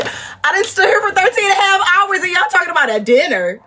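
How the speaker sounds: high, incredulous rant; exasperated. Retold or imitated. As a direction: squeal it in disbelief.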